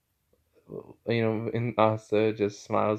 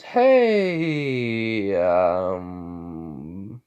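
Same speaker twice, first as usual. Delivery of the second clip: long stretched hey sliding into um; hesitant drawn-out greeting; 3 semitones lower, 4 dB louder than usual. Acted. Asta greets the mage he fought, drawn out and hesitant. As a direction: drag out the greeting, stalling for words.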